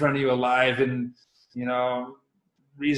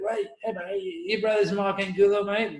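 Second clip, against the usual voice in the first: put-on higher, playful Australian accent; Aussie accent; 6 semitones higher than usual. Aki quizzing a young kid about his brother, Aussie accent. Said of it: "This is my best Aussie accent".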